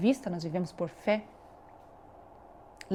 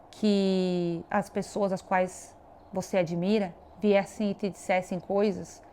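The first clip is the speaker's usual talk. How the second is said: usual speaking voice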